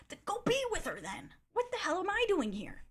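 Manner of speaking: high-pitched exasperated retort. Retold or imitated. Reacting to him crying over his ex, exasperated.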